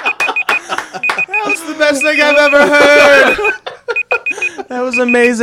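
high, loud, drawn-out rave through laughter; delighted amazement. Real, not acted. Co-host reacts to the surprise rap, in delighted amazement. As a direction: gush loudly, stretching the last word way out.

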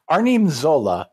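put-on movie-villain German accent. Podcaster demonstrating how the film character talks, mock German accent. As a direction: imitate Arnim Zola's German accent. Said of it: "he talks like this".

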